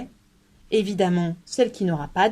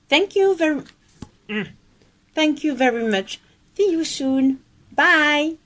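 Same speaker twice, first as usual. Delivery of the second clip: mock English goodbye; 7 semitones higher, 3 dB louder than usual. English sign-off, higher voice.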